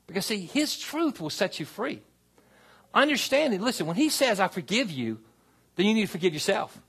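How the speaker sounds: impassioned pastor rousing the church; full-force preaching. Acted. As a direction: preach it out to the back row.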